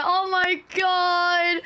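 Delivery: slow, drawn-out awed "Oh my God"; stunned gasp. Retold or imitated. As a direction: let it out slowly, overwhelmed. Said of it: "I was watching it and I was like".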